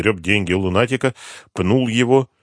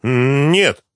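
hesitant, drawn hum before denying; crushed denial; 7 semitones higher, 6 dB louder than usual. A narrator's reading. Addict realises he let it slip, crushed voice. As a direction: deny it as if caught out. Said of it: "ответил Лунатик убитым голосом".

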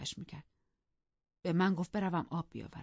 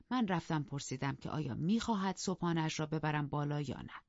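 usual speaking voice

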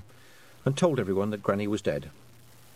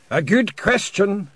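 slow, high, loud character voice; courteous and deliberate, ringing out clearly; polite; 11 semitones higher, 9 dB louder, slower than usual. A narrator's reading. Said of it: "he said politely".

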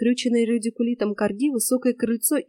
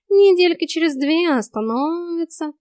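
high, slow, stretched cat drawl; talking cat; 7 semitones higher, 4 dB louder than usual. A narrator's reading. Cat promises the hair will stop growing, drawling. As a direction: draw it out high, making light of it.

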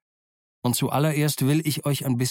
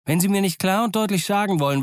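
higher, insistent complaint; aggrieved Silver; 8 semitones higher, 4 dB louder, faster than usual. A narrator's reading. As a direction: protest man to man in a raised voice. Plays Silver complaining to doctor. (Silver, gekränkt und eindringlich)

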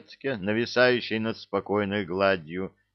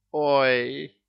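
louder, higher startled "oy"; dismayed oops; 5 semitones higher, 4 dB louder than usual. A narrator's reading. Cat caught out by his own hiccup, dismayed oops. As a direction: yelp it after a hiccup.

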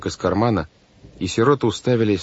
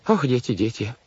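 higher-pitched weary sigh; 5 semitones higher than usual. A narrator's reading. Banker despairs of the children, sighing. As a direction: sigh the words out. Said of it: "вздохнул мистер По".

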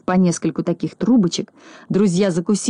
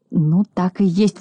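ordinary delivery, nothing marked

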